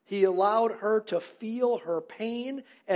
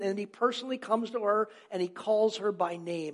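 usual speaking voice

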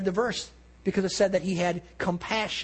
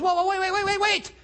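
alarmed pleading; 11 semitones higher, faster than usual. Retold or imitated. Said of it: "And he goes".

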